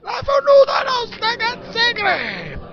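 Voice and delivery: high, slow furious yell; angry shout. Acted. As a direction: scream it at the kitchen, dragged out. Chef explodes at kitchen staff.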